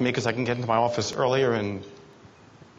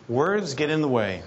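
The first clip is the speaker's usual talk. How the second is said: ordinary talk, nothing marked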